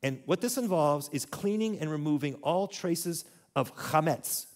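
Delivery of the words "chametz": chametz with a throaty ch at the start, stress on the last syllable; chametz: guttural kh, stressed METS; Hebrew pronunciation. Shown as a demonstration. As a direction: say chametz as khah-METS with a rasped kh. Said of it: "Can you say that?".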